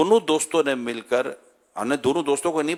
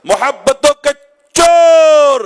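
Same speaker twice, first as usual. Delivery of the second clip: much higher, full-throated slogan; rallying shout; 12 semitones higher, 16 dB louder, much slower than usual. Real, not acted. Preacher leading a slogan against talebearers, full shout. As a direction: bellow it for the crowd. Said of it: "نعرہ زوردار لگے".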